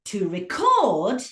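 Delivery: to reCORD as a verb; stress on second syllable. Shown as a demonstration. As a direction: lean hard on CORD in record. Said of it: "put it on the second syllable".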